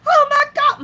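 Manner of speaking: high, loud mock squeal; hammed-up fangirl cry; imitated excited fan. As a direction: shriek it like a starstruck fan. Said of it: "And she was so excited".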